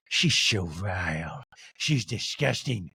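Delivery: very slow, low disdain; Meredith impression. Castmate confiding disgust to another, slow mock disdain. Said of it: "Meredith to Monica goes".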